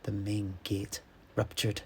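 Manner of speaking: usual speaking voice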